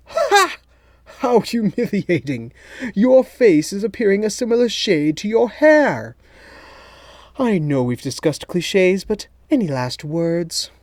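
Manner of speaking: high, loud, theatrical villain voice; a smug mastermind savouring the moment; gloating villain. A narrator's reading. Villain mocks Saletti's red face.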